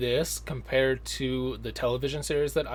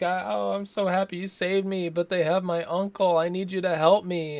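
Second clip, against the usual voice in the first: put-on higher, pleading girlish voice; lightly voiced damsel begging for help; rescued girl; 6 semitones higher than usual. Reviewer voicing rescued girl begging Seagal, higher mock plea. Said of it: "the girl's like".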